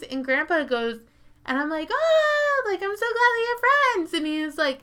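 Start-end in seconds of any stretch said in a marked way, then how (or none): 1.9-4.1 very high, loud, chirpy voice; mock sweet. Narrator to Grandpa, high chirpy mock cheer. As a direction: play it naive and cheerful. Narrator's naive retold remark.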